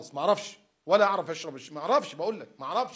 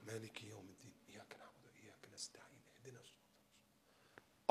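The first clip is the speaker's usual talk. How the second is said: breathy, silent recitation under the breath; whispered prayer; 7 semitones lower, 19 dB quieter than usual. Shown as a demonstration. Preacher showing how to pray calmly, whispering. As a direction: murmur it as if praying alone. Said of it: "فكر".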